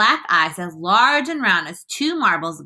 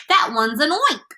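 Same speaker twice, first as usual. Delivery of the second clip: raised, alarmed cry; mild scream; 7 semitones higher, 3 dB louder than usual. A narrator's reading. Fire chief sees the grasshopper, mildly screaming. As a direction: cry out high in mock alarm. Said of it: "screamed the head of the fire department".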